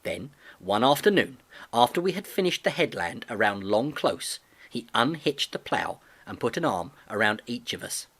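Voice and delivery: ordinary delivery, nothing marked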